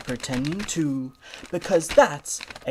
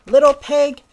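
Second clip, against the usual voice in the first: scolding call; 9 semitones higher, 9 dB louder than usual. Acted. Duck scolds pig.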